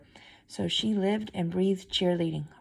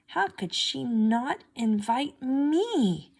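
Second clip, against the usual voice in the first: slow, higher hurt question; hurt thought; 6 semitones higher, much slower than usual. A narrator's reading. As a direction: ask it slowly, holding back tears. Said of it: "she thought to herself".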